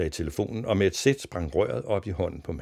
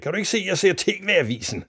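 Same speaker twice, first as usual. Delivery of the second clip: raised, high irritated voice; irritated shout; 12 semitones higher, 4 dB louder than usual. A narrator's reading. Father interrupted during the TV news, irritated shouting. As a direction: scold like an annoyed father. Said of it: "råbte faren irriteret".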